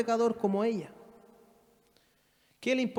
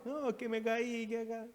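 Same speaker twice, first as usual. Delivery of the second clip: put-on crying child; 5 semitones higher, 8 dB quieter than usual. Crying child answers parent.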